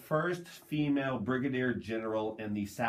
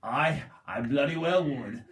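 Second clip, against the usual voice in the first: lightly voiced Australian drawl; Australian accent; slower than usual. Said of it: "my Australian accent".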